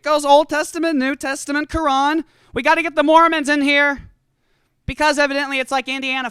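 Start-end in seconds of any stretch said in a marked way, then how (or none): none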